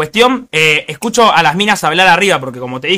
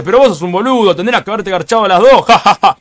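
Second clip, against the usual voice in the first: imitating uncle; 2 semitones higher than usual. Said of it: "Y me dice".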